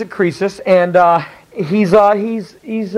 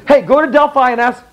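king giving orders; 7 semitones higher than usual. Retold or imitated. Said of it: "When the king says".

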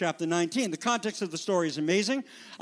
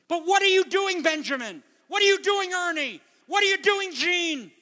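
higher and louder, pressing each name; rousing call; 9 semitones higher, 5 dB louder than usual. Real, not acted. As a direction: challenge them to their faces. Preacher calling out church members.

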